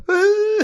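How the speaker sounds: very high "woo"; sudden piercing pretend cry; mock wail. Shown as a demonstration. Uncle showing how to trick babies, high mock wail. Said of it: "You just start going".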